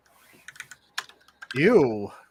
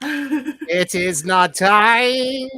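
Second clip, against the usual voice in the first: slow eerie voice; 4 semitones higher, 3 dB louder, much slower than usual. Acted. S1 mimics movie line.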